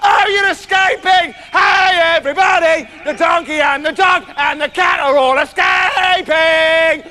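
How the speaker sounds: high, drawn-out shouted crow; loud, oblivious rooster waking everybody. Acted. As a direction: crow every word at the top of your voice. Rooster announces the escape.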